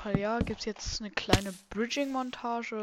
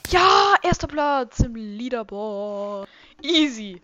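triumphant; 11 dB louder, slower than usual. Real, not acted. First place on leaderboard.

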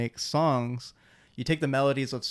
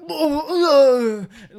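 mock annoyed grunt; 13 semitones higher, 12 dB louder than usual. Friend's mad reaction retold.

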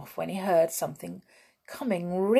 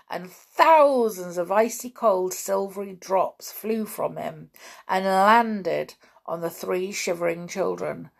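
ordinary delivery, nothing marked

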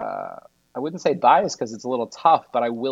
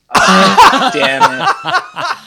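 shoots up high and loud into a laughing squeal; a drunk guy's comic dismay, squeaked out; disappointed squeal; 12 dB louder, much slower than usual. Retold or imitated. Drunk guest refused a table, squealing with laughing dismay. Turned away at restaurant, retold.

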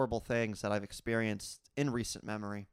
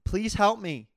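raised, higher imitation of a screamed plea; retold scream; 9 semitones higher, 7 dB louder than usual. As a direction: cry out for help, mimicking a caller's scream. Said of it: "She was screaming".

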